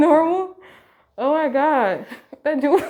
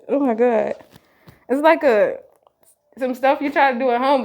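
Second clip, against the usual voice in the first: usual speaking voice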